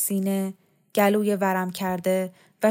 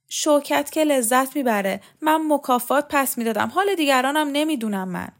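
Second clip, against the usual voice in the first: higher-pitched, mildly agitated retort; agitated nephew; 6 semitones higher, 3 dB louder than usual. A narrator's reading. Nephew answers his distraught aunt about the listeners, mildly agitated. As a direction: answer back with a touch of agitation.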